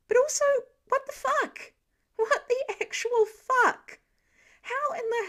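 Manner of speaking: high, loud, incredulous swearing; can't believe what he's seeing; stunned disbelief. A narrator's reading. Archer gaping at the kid's strength, shocked. Clint's shocked inner outburst.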